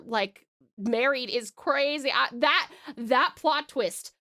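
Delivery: plain reading voice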